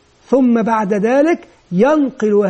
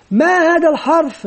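higher-pitched classroom question; teacher quizzing; 4 semitones higher than usual. Acted. Teacher asking children about the letter, quizzing. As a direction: prompt the class for an answer.

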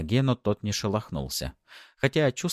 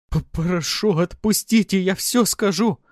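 high, loud stammering plea; terrified captive begging; panicked pleading; 12 semitones higher, 6 dB louder than usual. Acted. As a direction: beg for your life, voice shooting up. Beaten captive stammers and begs.

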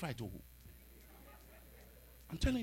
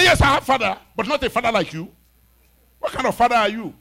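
louder retort to an elder; defiant retort; 3 semitones higher, 8 dB louder than usual. Retold or imitated. Boy defying the old man, loud retort. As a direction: talk back hard to the old man.

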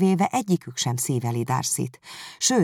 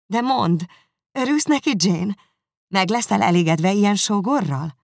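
higher, louder happy sister; Elizabeth happy; 5 semitones higher, 5 dB louder than usual. Acted. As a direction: reassure her joyfully. Elizabeth reassures Jane.

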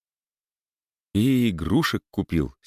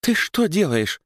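alarmed scolding; 10 semitones higher than usual. Acted. Wife alarmed at husband.

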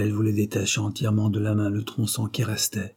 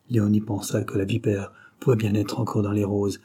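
ordinary delivery, nothing marked

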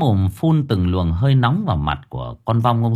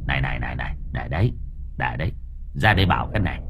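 much lower and softer, a confidential murmur; hushed aside; 9 semitones lower, 7 dB quieter, much faster than usual. Acted. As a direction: draw them aside quietly for a secret word. Pulls spirits aside confidentially.

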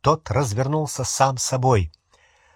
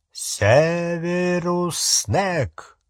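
long drawn-out, menacing call of the name; eerie loud whisper; 5 semitones higher, much slower than usual. A narrator's reading. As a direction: stretch the name out like a ghostly warning. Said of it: "Громкий шёпот грозного глаза Грюма". (громкий грозный шёпот, очень протяжно)

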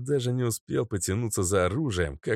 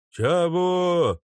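offended shout; 10 semitones higher, 6 dB louder than usual. Acted. Guard offended.

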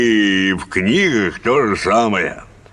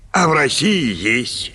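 usual speaking voice

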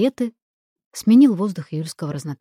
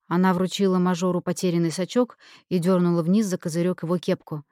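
ordinary delivery, nothing marked

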